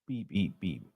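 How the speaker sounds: tiny, hushed beep noises; idle low beeping; whispered beeps. Pretend. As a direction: beep very softly under your breath. Idle beeping while reading chat.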